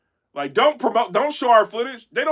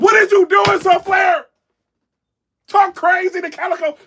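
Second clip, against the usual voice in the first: hyped-up urging; 7 semitones higher than usual. Retold or imitated. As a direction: fire him up to go at everybody. Retold hype to Saflair.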